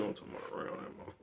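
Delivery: quick hushed whisper; real whisper, mama nearby. Real, not acted. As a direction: keep it low so mama won't hear.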